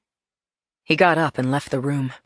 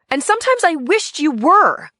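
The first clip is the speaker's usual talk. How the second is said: high, loud, cutting jab; vehement; 13 semitones higher, 6 dB louder than usual. Acted. Wife to husband, vehement and high. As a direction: spit it out with vehemence. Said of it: "I said it with vehemence".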